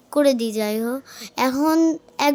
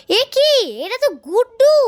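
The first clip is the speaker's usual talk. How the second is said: shocked; 9 semitones higher than usual. Acted. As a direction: gasp it out in shock. Shocked discovery of box.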